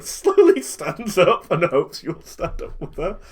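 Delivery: ordinary delivery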